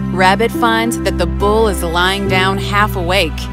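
plain reading voice

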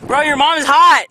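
mocking taunt.

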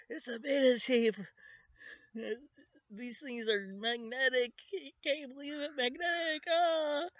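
very high, fast, gushing squeal; thrilled collector, voice climbing to a squeak; jazzed geek. Retold or imitated. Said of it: "he's all like".

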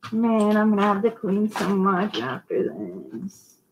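soft, whiny complaint. Real, not acted. Seller grumbling to viewers about cleanup afterwards, whiny. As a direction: grumble it softly in a whine. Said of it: "That's my whiny voice".

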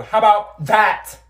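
loud, slow, sassy "how about that"; sassy comeback. Acted. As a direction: throw it in their face.

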